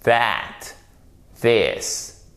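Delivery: throaty voiced TH on "That, this,"; guttural TH. Shown as a demonstration. Teacher repeats "That, this," for learners, guttural pronunciation. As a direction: say "That, this," separately, buzzing TH from the throat. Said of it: "avec la gorge".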